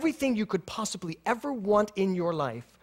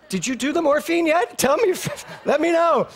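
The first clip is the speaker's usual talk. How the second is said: laughing joke; 11 semitones higher, 6 dB louder than usual. Retold or imitated. Joking retold thought under anesthesia.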